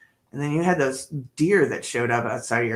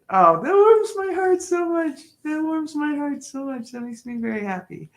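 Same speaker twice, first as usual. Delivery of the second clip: much higher, touched and gushing; delighted gush; 12 semitones higher, 4 dB louder than usual. Real, not acted. Streamer thanking a grateful viewer, high delighted gush. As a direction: let the joy lift your voice right up.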